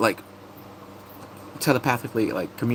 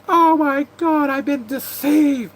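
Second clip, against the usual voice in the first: much higher, slow, hammy gasp of betrayal; hammed-up shock; 5 dB louder, slower than usual. Vlogger hamming up William finding the kiss, much higher and slow. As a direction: overplay the shocked lover, high and drawn out.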